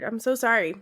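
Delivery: ordinary talk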